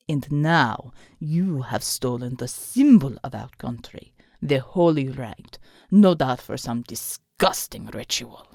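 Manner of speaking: low, slow menacing snarl; snarling villain. A narrator's reading. The thug snarls his accusation at Alma. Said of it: "the man snarled at Alma".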